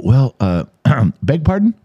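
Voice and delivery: hesitant polite query, rising high at the end. Acted. Host showing how he used to ask his friend, politely hesitant. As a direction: clear the throat, then politely ask for a repeat. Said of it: "I used to be polite and try to go".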